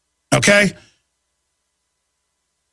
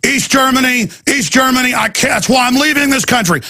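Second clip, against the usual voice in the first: raised protest; 7 semitones higher than usual. Retold or imitated. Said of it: "he was going".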